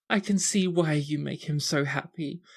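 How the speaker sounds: put-on overflowing girlish happiness; gushing teary sister. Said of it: "eyes are so big and soft and sad".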